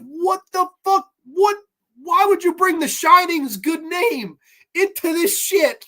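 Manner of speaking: high-pitched, indignant outburst; outraged disbelief. Retold or imitated. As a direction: replay your appalled reaction to the screen. Acts out outraged reaction to film.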